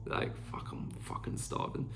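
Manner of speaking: hushed whispered curse; whispering. Real, not acted. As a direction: whisper it under your breath. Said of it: "so hungry right now".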